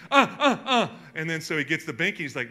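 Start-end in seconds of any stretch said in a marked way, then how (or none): 0.1-0.9 high, loud wordless grunts of uh, laughing through them; mock a kid pointing and whining; imitating toddler grunts. Father mimicking his wordless kid, high grunting.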